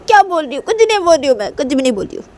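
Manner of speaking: high, defensive daughter; indignant protest. Acted. Daughter insisting to mummy she said nothing, high and indignant. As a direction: protest innocence, starting high and dropping.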